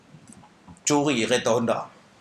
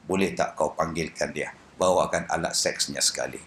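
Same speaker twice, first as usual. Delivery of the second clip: put-on blind old Kasim; 10 semitones lower, 4 dB quieter than usual. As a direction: drop low to play blind Kasim. Blind Kasim asks for Badin. (suara Kasim yang buta, rendah)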